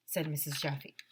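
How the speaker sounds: plain reading voice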